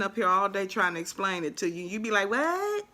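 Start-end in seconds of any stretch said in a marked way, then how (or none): 2.3-2.9 high, baffled what; confused viewer. Retold or imitated. Crafter imitating a confused viewer, high baffled. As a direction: play the lost viewer.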